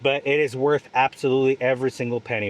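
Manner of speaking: usual speaking voice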